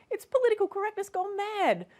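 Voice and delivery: high-pitched, hammed-up indignation; mock outrage. Satirical host pretends to defend the politician, mock outrage.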